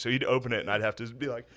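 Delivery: normal talking voice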